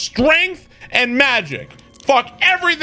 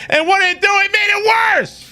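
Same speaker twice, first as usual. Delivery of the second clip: angry shout; 9 semitones higher than usual. Real, not acted. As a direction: shout it like the game betrayed you. Raging over the wasted money.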